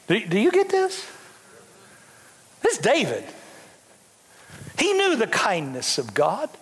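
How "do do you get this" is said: ordinary delivery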